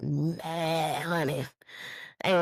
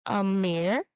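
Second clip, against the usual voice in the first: usual speaking voice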